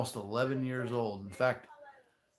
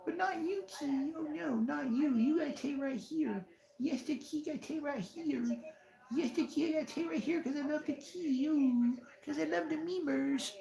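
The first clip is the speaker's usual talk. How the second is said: put-on baby talk to cat. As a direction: coo at the kitty like a baby. Cooing to the cat.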